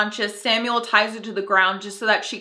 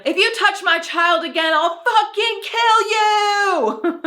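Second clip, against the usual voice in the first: much higher, louder, slow furious scream; screamed threat; 11 semitones higher, 4 dB louder, slower than usual. Retold or imitated. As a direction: scream it at the monster. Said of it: "she just screams at him".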